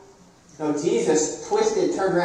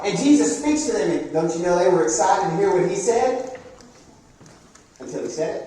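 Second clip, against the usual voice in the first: normal talking voice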